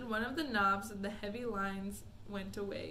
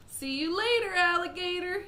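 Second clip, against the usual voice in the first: higher, louder cheeky call; shouted; 8 semitones higher, 8 dB louder than usual. Acted. Mike shouts cheeky farewell before the switch. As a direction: fling the goodbye over the shoulder. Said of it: "he shouted".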